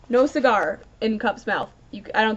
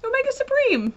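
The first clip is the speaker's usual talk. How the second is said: much higher, gushing name; awestruck. Real, not acted. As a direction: say the name in giddy awe. Awed by Omega Supreme appearing.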